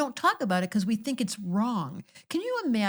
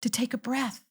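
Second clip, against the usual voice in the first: preaching; 4 semitones higher than usual. Acted. Preaching, slow emphatic.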